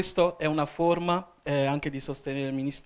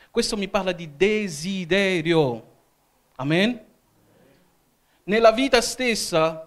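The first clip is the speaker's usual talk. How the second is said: raised, higher-pitched sermon delivery; preaching; 5 semitones higher, 6 dB louder than usual. Real, not acted. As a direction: project each phrase to the congregation with conviction. (predicazione accalorata, a voce alta)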